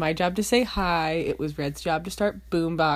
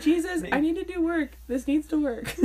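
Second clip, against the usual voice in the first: high-pitched, hammed-up plea; pleading prayer; 10 semitones higher than usual. Phone owner re-enacting prayers to Jesus, pleading. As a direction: beg heaven with mock desperation. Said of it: "I literally pray sometimes".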